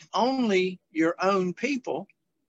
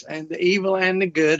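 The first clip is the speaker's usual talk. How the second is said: faster pass at the phrase; brisk reread of the phrase; quicker; 3 dB louder, faster than usual. Real, not acted. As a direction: say it quicker, as asked. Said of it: "So you can say it quicker".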